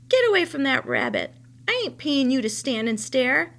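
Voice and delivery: loud, sharp scolding. Acted. Old woman scolding the boy for staring. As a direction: tell him off loudly.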